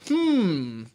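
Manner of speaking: drawn-out, musing hum; pensive observer. Acted. Would-be philosopher watching normal people, a hint of pensive observer.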